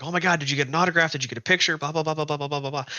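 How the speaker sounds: fast, high, gushing mock fan chatter; imitated excited crowd. Campers mob the family about an autograph, imitated excitement. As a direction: babble like starstruck fans, running into blahs. Acting out crowd flooding the campsite.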